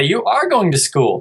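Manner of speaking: patient parent modelling the word; gentle correction. Shown as a demonstration. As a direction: say "school" back with a clean K sound. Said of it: "but I'd say".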